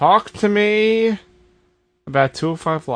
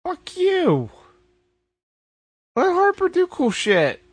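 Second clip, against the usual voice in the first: high-pitched indignant outburst; sticking up for a wronged favorite; indignant protest; 12 semitones higher, slower than usual. Real, not acted. Protesting on behalf of Harper.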